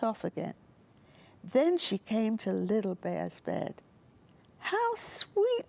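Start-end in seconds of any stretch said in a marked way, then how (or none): 4.6-5.7 delighted. A narrator's reading. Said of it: "she said".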